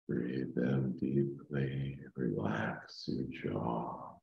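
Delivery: slow, soft meditation voice; calm meditative tone. Acted. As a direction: ease them down into calm. Leads a guided meditation.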